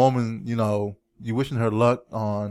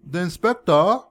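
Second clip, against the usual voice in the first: hammed-up, higher English accent; bad English accent; 5 semitones higher, 4 dB louder than usual. Repeating it for puzzled spouse, bad English accent. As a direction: offer it in a poor English accent. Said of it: "your worst English accent ever".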